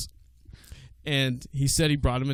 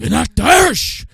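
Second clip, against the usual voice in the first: put-on higher, louder Cuban Scarface accent; 9 semitones higher, 7 dB louder than usual. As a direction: push harder into the Scarface accent. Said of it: "I can't do it".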